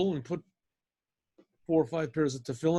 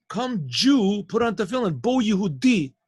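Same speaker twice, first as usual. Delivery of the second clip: loud repeated call; 5 semitones higher, 6 dB louder than usual. Retold or imitated.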